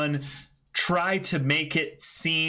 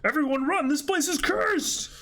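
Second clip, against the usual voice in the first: high panicked yell. Acted. Reading a pirate crew warning, high panicked yell.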